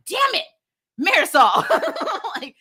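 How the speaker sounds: high, lightly hammed-up scolding; mock exasperation. Fans scolding Marisol over a secret baby, mock exasperated. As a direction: tease the mother like fed-up fans would. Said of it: "a lot of people immediately were like".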